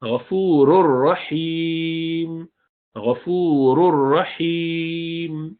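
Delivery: غفور الرحیم with the tanween merged into ra; drawn-out chant, no nun heard; slow recitation, nun gone. Shown as a demonstration. Teacher chanting example: slow merge into ra. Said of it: "تنوین کا نون وہ بھی گیا".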